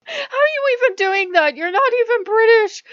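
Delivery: high, loud mock disbelief; incredulous outburst. Viewer challenging a fake British accent, incredulous. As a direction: squeal it, baffled and loud. Acted outburst at the imitated man.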